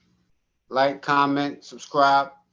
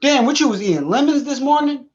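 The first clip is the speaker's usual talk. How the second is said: mocking coworker; 11 semitones higher, 5 dB louder than usual.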